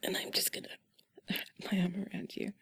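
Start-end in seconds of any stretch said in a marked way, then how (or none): none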